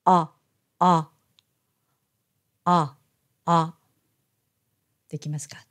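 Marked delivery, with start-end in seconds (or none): 0.0-3.8 low-pitched "あ" between Japanese o and a; short, low "あ" with relaxed lips; open back vowel. Shown as a demonstration. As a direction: say "あ" from the back of the throat, jaw dropped. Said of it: "喉の奥の方で発声します".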